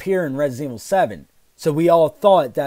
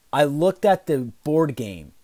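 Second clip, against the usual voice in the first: ordinary delivery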